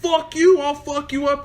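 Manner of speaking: high-pitched mock threat; imitated yelling. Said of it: "he's yelling".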